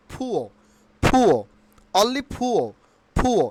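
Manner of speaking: "ফুয়ো" with puffed P, no R; correct pronunciation. Shown as a demonstration. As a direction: say "ফুয়ো" as "phu-o". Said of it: "Only ফুয়ো". ("ফুয়ো", শেষে র ছাড়া)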